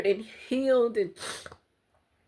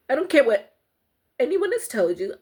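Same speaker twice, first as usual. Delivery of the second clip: raised, higher and louder with conviction; impassioned plea; 5 semitones higher, 5 dB louder than usual. Real, not acted. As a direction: insist fiercely that they matter. Impassioned encouragement to abuse victims.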